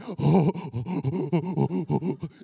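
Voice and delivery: loud, excited doggy panting; huffing, overjoyed dog impression; imitated happy dog panting. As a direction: pant like an eager dog. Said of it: "And, and he's like".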